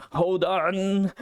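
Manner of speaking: Paula. A narrator's reading. Paula pulling Saf up the cliff, drawn-out shout. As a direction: stretch it out as a straining call, not full volume. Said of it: "Like, Paula shout".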